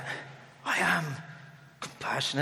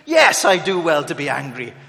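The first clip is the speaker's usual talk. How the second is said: louder petulant retort; sulky strop; 8 dB louder than usual. Retold or imitated. Jonah sulking back at God in a strop. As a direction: answer back like a sulking child. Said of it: "he's having a strop".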